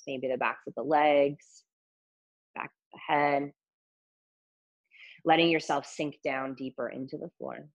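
calm body scan guidance; guided meditation. Acted.

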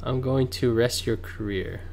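usual speaking voice

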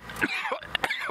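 sharp real cough; coughing fit. Real, not acted. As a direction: cough hard to clear the throat. Just swallowed a bug.

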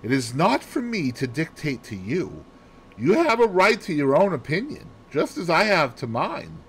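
mildly huffy dignity; offended but formal; cold. A narrator's reading. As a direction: disagree stiffly, pitch climbing. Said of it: "I said coldly".

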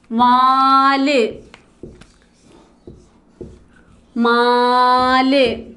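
each word stretched very slowly with long pauses; slow dictation. Shown as a demonstration. Teacher dictating words for the class to write, very slowly. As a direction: dictate word by word, drawing every vowel out. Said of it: "നമ്മൾ കുറച്ച് പദങ്ങൾ എഴുതാം".

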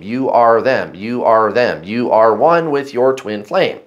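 normal talking voice

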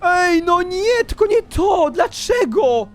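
high, drawn-out lament; high-pitched, stretched cry of dismay; wailing protest. Real, not acted. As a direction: wail at how unfair it is. Protesting after losing progress.

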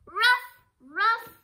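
high loud barking ruff ruff; dog bark. Acted. The dog barking back at Maddie, high and loud. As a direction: yap like a small dog.

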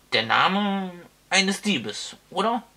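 put-on slow, rising high, knowing old wizard; sly old wizard. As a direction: tease him with a slow sly question. Wizard musing slyly. (verschmitzt, langsam, hoch)

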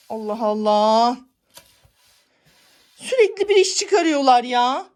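loud high fed-up complaint; fed-up outburst. Real, not acted. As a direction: vent loudly at the trouble. Fed up with cats. (bıkkın bağırış)

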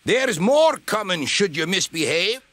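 high, cross threat; angry warning. A narrator's reading. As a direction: warn him sharply in a high voice. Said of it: "Donald burst out".

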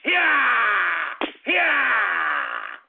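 drawn-out falling "yeah" with each lash; pretend whipping yell. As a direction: yell as you swing the belt. Pretends to whip with belt.

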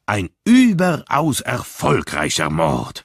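slow, grave congratulation; solemn. A narrator's reading. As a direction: say it solemnly, like an honour. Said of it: "sagte Poirot feierlich".

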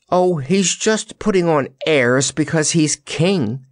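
high, lilting tease; teasing. A narrator's reading. Jellia teases about the floating lion, teasing. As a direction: poke fun at him lightly. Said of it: "teased Jellia".

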